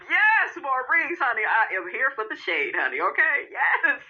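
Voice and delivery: ordinary talk, nothing marked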